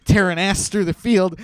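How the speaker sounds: normal talking voice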